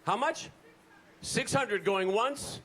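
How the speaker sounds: high, drawn-out auctioneer calls. Pretend. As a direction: call the bids like an auction. Running a mock auction.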